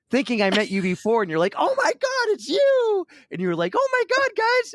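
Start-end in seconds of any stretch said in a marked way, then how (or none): 1.6-3.1 very high, squealing mock fangirl; excited fan. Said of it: "so excited". 3.8-4.7 very high imitated fan squeal; excited fan. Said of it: "so excited".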